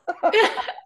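high, loud burst of laughter. Real, not acted. Guest laughing at her surreal moment, high laugh.

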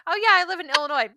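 badly attempted local accent, quick and high; attempted Illinois accent. Co-host jokes about living in Illinois, attempted accent. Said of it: "I can't do the accent".

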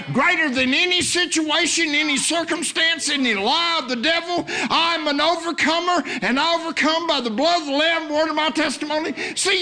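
high, fervent preaching building to shouts; full-force preaching. Real, not acted. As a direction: preach it boldly, high and driving.

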